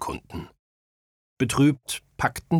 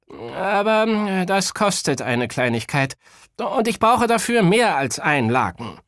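lightly voiced boy, higher and hesitant at first; bargaining young tailor; 9 semitones higher, 4 dB louder than usual. A narrator's reading. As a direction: haggle as a young tailor naming terms. (zögernd verhandelnder junger Schneider)

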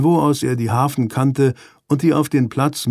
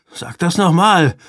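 higher-pitched, heated dare; angry challenge; 6 semitones higher, 4 dB louder than usual. A narrator's reading. Ruben charging at his brother after an insult, angrily challenging. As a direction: challenge him with a flash of anger. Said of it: "Ruben schoss wütend auf ihn zu".

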